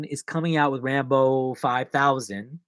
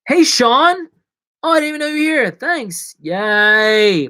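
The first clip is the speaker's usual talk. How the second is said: high, loud happy surprise; pitched-up, gleeful welcome; excited greeting; 9 semitones higher, 7 dB louder than usual. Real, not acted. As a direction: greet a newcomer with a delighted squeal. Greeting a viewer who turned up.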